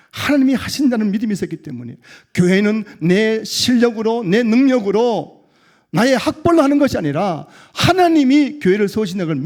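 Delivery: loud, high, emphatic pulpit delivery; impassioned sermon proclamation; full-force preaching. Real, not acted. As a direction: drive each phrase home to the congregation.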